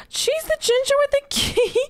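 high, delighted squeal after a gasp. Real, not acted. Gasping at a cute setup.

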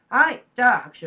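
loud, rousing cue to clap; calling for applause. Real, not acted. Teacher ends the Confucius segment asking for applause, calling out loudly.

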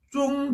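"쫑" high, level and held; first tone. Shown as a demonstration. Teacher answering the first syllable, "쫑" high level tone. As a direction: hold "쫑" steady on a high note. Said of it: "앞 글자는 일 성이니까".

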